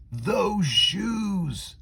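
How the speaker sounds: high, loud gasp of delight; a boy spotting the perfect sneakers, thrilled; excited cry. Acted. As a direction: blurt it out like you found treasure. Boy spots the dream shoes.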